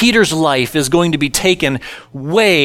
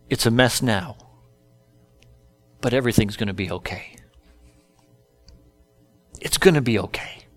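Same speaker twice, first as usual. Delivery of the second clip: softer and lower, quietly comforting; gentle reassurance; 5 semitones lower, 7 dB quieter, faster than usual. Retold or imitated. Jesus comforting Peter after his denial, soft and low. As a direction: hush it down like calming a child.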